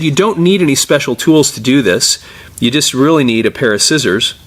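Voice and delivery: usual speaking voice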